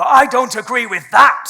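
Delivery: loud indignant retort; hammed-up keyboard warrior; mock outraged commenter. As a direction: fire it back like an angry commenter. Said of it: "we go".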